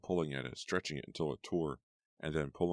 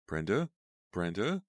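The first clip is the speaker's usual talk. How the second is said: desperate calling; 5 semitones higher, 6 dB louder than usual. A narrator's reading. Shaking dead friend.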